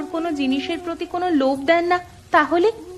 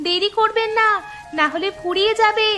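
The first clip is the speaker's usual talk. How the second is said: hawker's cry; 4 semitones higher than usual. Acted. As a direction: call out to passing buyers, pitched high. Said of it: "তোমরা চিৎকার করছিলে". (আম বিক্রেতার চড়া গলায় টানা হাঁক)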